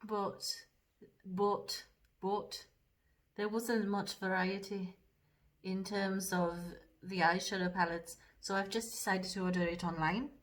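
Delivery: put-on lightly done Geordie accent, a bit fast at first; chatty Geordie accent while doing makeup; a hint of Geordie accent in casual chat. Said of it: "that's about as much of the accent".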